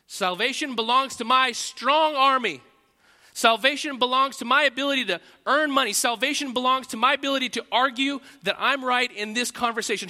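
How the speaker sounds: loud, high, hammed-up declaration; sarcastic loud proclaiming; mock proclamation. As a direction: proclaim each false saviour with mock grandeur. Mocking the false claims.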